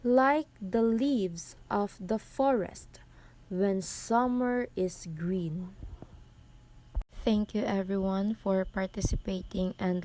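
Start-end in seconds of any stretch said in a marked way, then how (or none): 0.0-5.7 slow verse, "Like the LEAVES of the FORest when SUMmer is GREEN"; anapestic stress. Shown as a demonstration. Presenter reading Byron, "Like the leaves of the forest" slowly in anapests. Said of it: "is placed on the third syllable".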